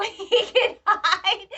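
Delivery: giggling through the words; laughing. Real, not acted. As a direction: crack up while telling it. Laughing while retelling funny scene.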